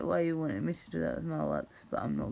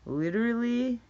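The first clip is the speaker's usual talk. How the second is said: mock valley girl; 6 semitones higher, 6 dB louder than usual. Mocking how lassies say it.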